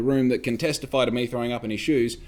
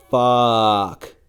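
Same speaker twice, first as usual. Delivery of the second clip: louder, heavy swear of dread; dismayed curse; 7 dB louder than usual. Retold or imitated. Alone realising they'll find out, a louder dismayed curse. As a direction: let the curse land like realising you're caught.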